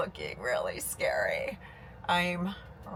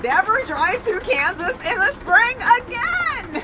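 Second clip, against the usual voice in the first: exasperated outburst; 13 semitones higher, 8 dB louder than usual. Real, not acted. After a night of storms.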